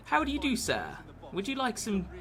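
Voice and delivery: mock upper-class toff offering tea; posh English. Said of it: "you have to sound like that".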